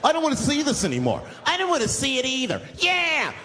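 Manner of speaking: high, indignant chiming in; suddenly brave passengers. Retold or imitated. Bus passengers join the protest, suddenly brave. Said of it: "now they're brave".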